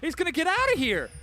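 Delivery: high, loud play-by-play shout; excited shouted call. Real, not acted. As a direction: yell out the narrow escape. Caster calls a daring escape.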